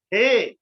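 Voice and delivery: loud, emphatic "Hey"; emphatic stress. Shown as a demonstration. Teacher showing students emphasis on words, stressed loudly. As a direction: punch "Hey" with a burst of volume. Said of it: "we are giving emphasis".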